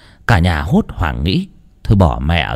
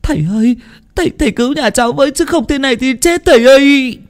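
high, fast tearful begging; crying plea; faster than usual. A narrator's reading. Wife begs the priest to save her husband, crying. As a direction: beg the priest to save him, sobbing. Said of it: "thì khóc òa lên".

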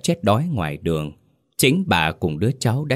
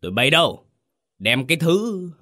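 higher, shouted call for servants; angry shout; 8 semitones higher than usual. Acted. Chief calls his servants to throw the woman out, shouting. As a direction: yell for the servants, pitch jumping up. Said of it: "quát".

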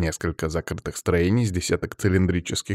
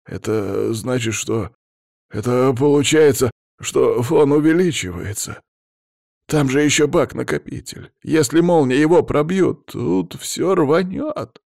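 rising panic, halting then high-pitched; station chief working out the disaster aloud; alarmed realisation; 6 semitones higher, 4 dB louder than usual. A narrator's reading. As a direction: start hesitant, climb into high alarm.

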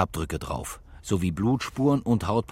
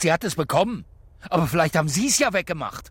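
raised, accusing interrogation; higher and louder, going on the attack; pressing; 9 semitones higher, 4 dB louder than usual. Acted. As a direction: cop turns up the heat on the suspect. Interrogator presses suspect about baby.

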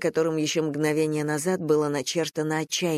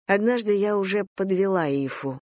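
usual speaking voice

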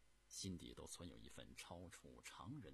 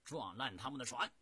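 loud, raised battle order; fierce commander ordering a charge, building louder; gritted-teeth fury; 4 semitones higher, 10 dB louder than usual. A narrator's reading. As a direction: shout the attack through clenched teeth. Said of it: "咬牙切齿地说".

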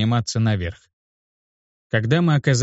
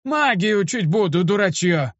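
put-on high-pitched irate old man, only mildly raised volume; scolding cry; 8 semitones higher than usual. A narrator's reading. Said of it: "закричал на меня старик". (сердитый старческий окрик)

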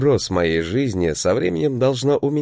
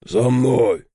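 gruff bear call; 3 dB louder than usual. A narrator's reading. Bear calls from the dark.

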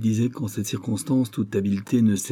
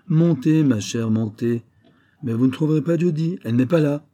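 mocking; 6 semitones higher, 5 dB louder than usual. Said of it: "Il éclata de rire".

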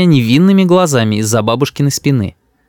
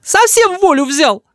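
very high, slow scolding; angry cry; slower than usual. A narrator's reading. Mother rebukes her unruly son, angry. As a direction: scold him at a high pitch. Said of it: "кричит мама".

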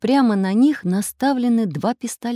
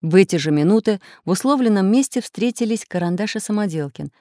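ordinary delivery, nothing marked

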